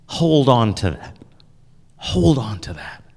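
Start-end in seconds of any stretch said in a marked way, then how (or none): 2.0-3.0 whispering. Shown as a demonstration. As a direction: whisper it like a secret. Repeats advice, then whispers it.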